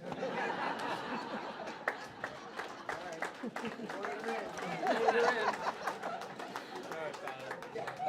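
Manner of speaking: a long, rising laugh at her own joke; long real laugh. Real, not acted. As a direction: let the laugh roll out and climb.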